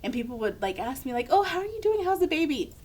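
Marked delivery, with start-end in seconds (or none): 1.3-2.7 high-pitched imitation of a well-meaning visitor; singsong small talk, pitched way up; mock friendly greeting. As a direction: coo the questions like a visitor greeting a new mother. Voicing people greeting a new mother.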